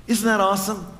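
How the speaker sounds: ordinary talk, nothing marked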